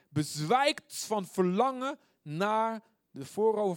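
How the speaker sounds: high, loud, drawn-out psalm; fervent yearning. Acted. Preacher voicing psalmist's longing for God's courts, fervent. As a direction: stretch the words with intense longing.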